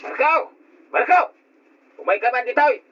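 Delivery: high, carrying call across the yard. Acted. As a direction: shout his name from a distance. Uncle calling boy from afar.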